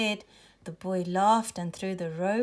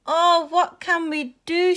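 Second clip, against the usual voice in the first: high, loud cry of dismay; shouted; 9 semitones higher, 7 dB louder than usual. A narrator's reading. Child to friends after losing the robot, shouted dismay. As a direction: call out in a raised, high voice. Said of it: "shouted Mo".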